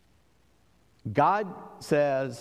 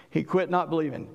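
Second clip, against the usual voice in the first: usual speaking voice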